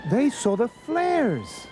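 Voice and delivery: high, stretched-out jubilant call; excited cry. A narrator's reading. Ralph hearing the rescue siren, excited slow cry. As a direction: exclaim it high, drawing out the words. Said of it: "cried Ralph".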